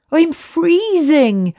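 high, slow chattering voice; drawn-out cold complaint; shivering from cold. A narrator's reading. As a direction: stretch it out like you're freezing. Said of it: "finding his voice again".

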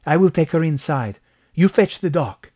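put-on firm Mexican-accented instructions. A narrator's reading. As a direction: take charge in a Mexican accent. Gabriel gives orders in an emergency.

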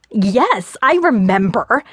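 rising rage. A narrator's reading. Fiona replies to Gabe as her rage deepens, rising rage. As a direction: answer with anger held in, pitch lifted. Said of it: "as my rage deepened".

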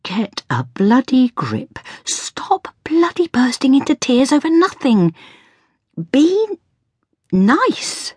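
high, strained voice with whispery edges; slow start, climbing to a high, fragile plea; tearful self-scolding. A narrator's reading. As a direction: berate yourself while fighting tears. Mother berating herself while tearful.

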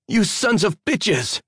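high-pitched, drawn-out yell; furious shout. A narrator's reading. Addie curses her captors as she is branded, shouting. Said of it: "she shouted".

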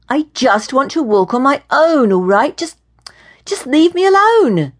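loud plea climbing to a high shout; insist, building to an outburst; exasperated. A narrator's reading. Woman who wants to walk on her own, exasperated.

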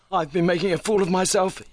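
high-pitched, mildly gasping visitor; gasped words. A narrator's reading. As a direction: say it as a light, high gasp. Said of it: "he gasped".

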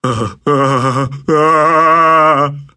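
heavy sigh. A narrator's reading. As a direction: let out a long weary sigh. Character sighs in delirium.

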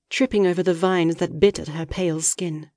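ordinary delivery, nothing marked

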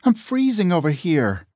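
lightly voiced sweet young woman; sweet female voice. A narrator's reading. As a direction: play Eve asking sweetly. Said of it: "came a sweet-sounding voice".

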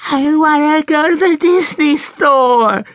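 very high, loud, drawn-out wail; little girl bawling her demand slowly; full child tantrum. A narrator's reading. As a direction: stretch every word in a bratty shriek.

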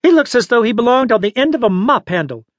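high, tart put-down; sharp-tongued old lady's disapproval. A narrator's reading. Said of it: "the old lady said tartly".

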